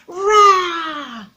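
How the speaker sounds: put-on loud demo roar for children to copy; dragon roar. Shown as a demonstration. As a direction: roar so the kids join in. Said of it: "Can you do that, children?".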